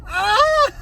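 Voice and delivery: high acted villain cackle; sinister laugh. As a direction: open on a high "ah", then cackle menacingly. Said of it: "Like a sinister laugh".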